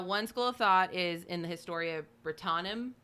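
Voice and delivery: normal talking voice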